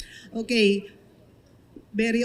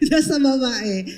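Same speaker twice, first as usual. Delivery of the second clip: laughing; 2 semitones higher than usual. Real, not acted. Laughing at own joke.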